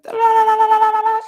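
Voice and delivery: high, loud nonsense babble mimicking someone talking at you; la-la-la a pushy monologue, high and loud; mock droning talker. Mocking people who talk at you, in a high loud la-la babble. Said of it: "Nobody wants to be talked at".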